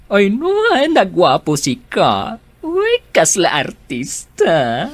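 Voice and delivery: high, swooning gush over a handsome man. Acted. Co-worker swooning to Rhea about the boss's fiancé, gushing. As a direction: swoon like a starstruck fan.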